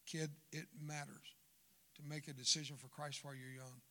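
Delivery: ordinary talk, nothing marked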